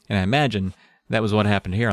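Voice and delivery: ordinary talk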